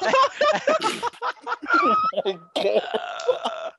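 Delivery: very high, long squealing laughter; high cackling laugh. Real, not acted. Laughing at terrible joke.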